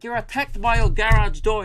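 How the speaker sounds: loud, high incredulous mock Kiwi host; New Zealand accent. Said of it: "I've got it now".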